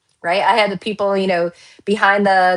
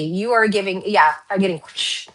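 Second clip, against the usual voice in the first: ordinary talk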